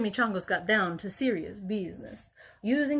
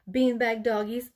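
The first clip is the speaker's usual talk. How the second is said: ordinary delivery, nothing marked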